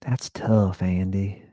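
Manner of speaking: whispered flamboyant Southern sympathy; Keith's whisper. Retold or imitated. Keith whispering sympathy to Andy in a flamboyant Southern voice. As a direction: breathe it like a camp Southern consoler. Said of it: "He kinda sounded like".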